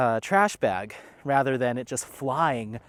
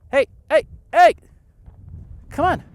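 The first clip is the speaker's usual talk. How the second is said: loud, much higher sharp calls; yelling at the dog; 13 semitones higher, 10 dB louder than usual. Real, not acted. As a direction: call the dog back hard. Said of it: "Sorry if you had to hear me yelling at him".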